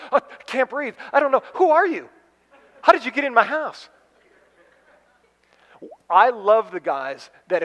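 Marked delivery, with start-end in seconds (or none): 0.1-3.9 high, gasping mock panic; mock shock. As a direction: pretend to be stunned breathless.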